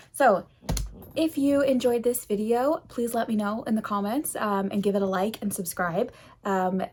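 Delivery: normal talking voice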